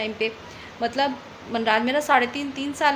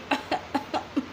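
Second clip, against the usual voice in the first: a short, soft, dry cough to show it; dry cough demo; 7 dB quieter than usual. Shown as a demonstration. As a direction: demonstrate the little throat cough. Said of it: "बस ऐसा हो रहा था". (हल्की सूखी खांसी का नमूना)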